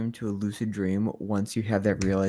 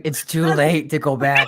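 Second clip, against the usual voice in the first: higher, louder mock voice of the co-host; teasing imitation; 4 semitones higher, 8 dB louder than usual. Teasing co-host about committing to the accent, mock imitation. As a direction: laughingly mimic her, pitched up and loud. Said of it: "she was like".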